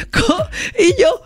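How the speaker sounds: real high laugh breaking through. Real, not acted. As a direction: laugh as you try to tell it. Se ríe contando el ataque. (riéndose de verdad)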